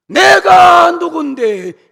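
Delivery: very loud, high, slow indignant yell; mock church member shrieking who am I; arrogant shout. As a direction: scream it like a wounded ego. Mocking sick church members.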